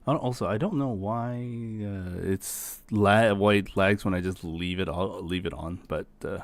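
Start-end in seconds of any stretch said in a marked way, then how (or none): none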